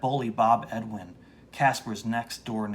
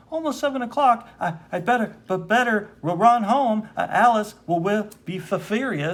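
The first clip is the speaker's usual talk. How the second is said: stammering panic; 9 semitones higher, 8 dB louder than usual. Acted. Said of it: "If I was reading correctly".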